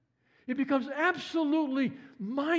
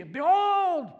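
excited exclaim; 6 semitones higher than usual. Retold or imitated. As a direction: cry it out like sudden wonder. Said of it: "Why does he suddenly go crazy?".

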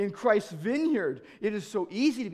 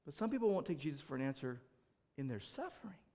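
softer and quicker, almost to themselves; hushed refrain; 5 semitones lower, 12 dB quieter than usual. Acted. Preacher repeating the refrain to the congregation, hushed and quick. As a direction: let it fall away sadly.

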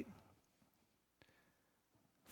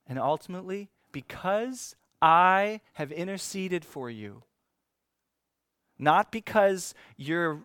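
high, loud, slowed preaching build; 5 semitones higher, 7 dB louder than usual. Real, not acted. Preacher to congregation, rising loud build. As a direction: lift and drive the point home.

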